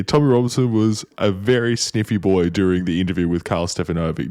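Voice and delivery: ordinary talk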